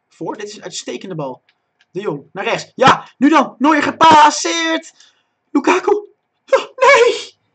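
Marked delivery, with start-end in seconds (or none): 2.8-7.4 high full-voice yell, names stretched; real excited shouting. Real, not acted. Commentator seeing Lukaku miss an open goal, screaming. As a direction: shout the chance up into a shriek of dismay. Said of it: "Dus ik zal niet zo schreeuwen".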